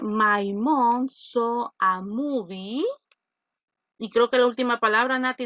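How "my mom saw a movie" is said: very slow, word by word, reading out a student's sentence; slow read-aloud. Shown as a demonstration. Teacher slowly reading Natalie's sentence aloud to the class. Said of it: "Natalie dice".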